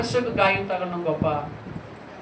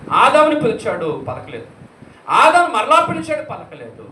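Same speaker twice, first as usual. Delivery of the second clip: dramatic preaching; 4 semitones higher, 9 dB louder than usual. Acted. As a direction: call out, then drop to a hush. Preaching God calling Adam. (నాటకీయ ప్రసంగం)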